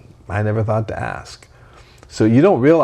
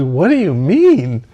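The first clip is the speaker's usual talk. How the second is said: put-on confused child; 5 semitones higher, 5 dB louder, slower than usual. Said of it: "he says".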